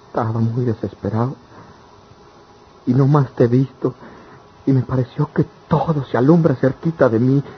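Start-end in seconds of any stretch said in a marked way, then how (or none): 2.8-7.4 higher, louder, swept up in emotion; rapturous declaration. Acted. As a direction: pour out the joy of seeing her. Lover declares his feelings.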